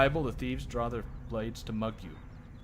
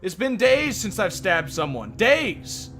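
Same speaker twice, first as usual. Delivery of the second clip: angry thief rant; 6 semitones higher, 8 dB louder than usual. Acted.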